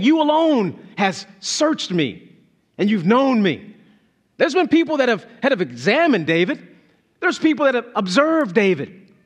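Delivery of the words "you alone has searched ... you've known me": "You alone" raised and slow; emphatic you alone. Shown as a demonstration. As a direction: draw out "You alone" as the point of the verse. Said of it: "he could almost be saying".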